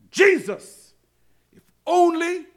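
loud, high pulpit wail; anguished cry to Jesus. Acted. Preacher voices the hurting calling on Jesus, crying out.

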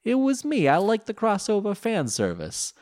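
very high cartoon voice; Joey's high voice. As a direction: squeak it out cheerfully. Joey voiced figure.

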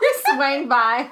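loud, slow teasing Florida drawl; mock Florida talk. Friend mimicking her slip, exaggerated Florida talk. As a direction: tease with a drawn-out Florida "swang by". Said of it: "some Florida talk for you".

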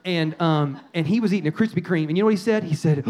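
ordinary talk, nothing marked

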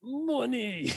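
put-on lightly British-accented money. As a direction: try out a posh British money. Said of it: "How do I say money British".

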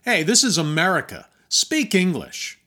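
loud, indignant "speak English" demand; mock bigot. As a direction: bark it like an annoyed local on the bus. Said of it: "says out loud".

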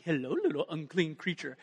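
light cooing pet voice, lightly done; sweet talk to a dog. Shown as a demonstration. Preacher shows the congregation how to greet their dog, mild cooing. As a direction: coo it like greeting a puppy. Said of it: "if you say it in the right voice".

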